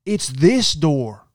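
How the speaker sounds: high, slow, exasperated drawl; disgruntled guest. Retold or imitated. Annoyed hotel guest scolding him at the door, exasperated. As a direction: huff it out like an annoyed stranger. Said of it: "this lady comes out disgruntled".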